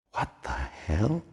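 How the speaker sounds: slow, hushed, a half-breathed question to herself; stunned inner thought. A narrator's reading. Margot to herself over the skeleton, hushed and slow. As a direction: let the shock leave her almost voiceless.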